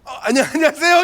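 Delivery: high, stammering, deferential "안녕하세요"; bowing greeting. Retold or imitated. Pastor meeting the angry driver after the crash, sheepishly deferential. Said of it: "나도 모르게 허리가 숙여지면서".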